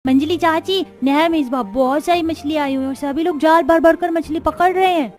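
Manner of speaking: put-on excited little boy. Boy Monu showing mother his fish, excited child voice. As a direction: call out proudly like a small kid.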